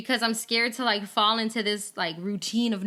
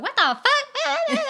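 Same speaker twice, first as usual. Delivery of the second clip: high, drawn-out, much higher and louder mock exclamation; mock shocked outburst; 6 dB louder, much slower than usual. Worker to friend, mock exclaiming after work. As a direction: let it burst out, slow and high. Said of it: "I get home and I'm like".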